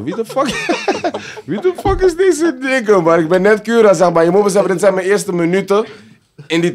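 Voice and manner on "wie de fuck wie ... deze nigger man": much higher, laughing through the words; cracking up in mock shock; laughing disbelief. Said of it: "Ik was zo".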